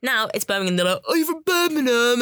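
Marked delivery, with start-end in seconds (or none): 1.1-2.2 higher, hammed-up Brummie accent; mock Brummie accent. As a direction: imitate a Brummie accent badly, pitched up. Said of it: "trying to imitate your accent".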